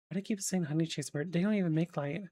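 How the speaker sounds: usual speaking voice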